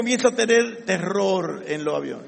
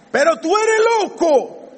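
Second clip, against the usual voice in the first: slower, higher, scornful imitation of a doubter; mock scoffing retort; 8 semitones higher, 5 dB louder, slower than usual. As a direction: mimic the doubter calling him crazy. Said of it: "Eso es lo que te van a decir".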